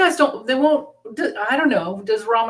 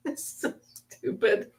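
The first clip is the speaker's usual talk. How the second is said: laughing; 5 semitones higher, 7 dB quieter, slower than usual. Real, not acted. Laughing at made-up word.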